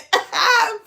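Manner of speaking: sudden high burst of laughter; high laughing fit. Real, not acted. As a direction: crack up at the joke. Cracking up at the time coincidence.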